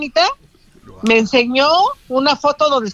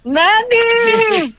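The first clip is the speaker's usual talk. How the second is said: high, long-drawn exclamation; real overwhelmed squeal; excited cry of a name; 8 semitones higher than usual. Real, not acted. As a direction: call it out high and stretch it. Reacts to the winner's news.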